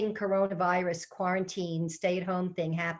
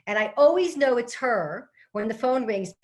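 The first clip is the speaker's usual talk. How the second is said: ordinary talk, nothing marked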